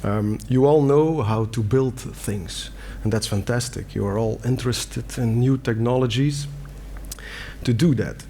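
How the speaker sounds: ordinary delivery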